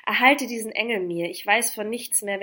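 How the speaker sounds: loud, urgent plea; called out. A narrator's reading. Lover begging friends to protect her, called out. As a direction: implore the friends with force. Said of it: "rief ich".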